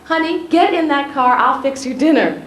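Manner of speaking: Maya Angelou insisting kindly. Retold or imitated. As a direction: lightly play the insistent hostess who won't take no. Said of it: "I said / reply".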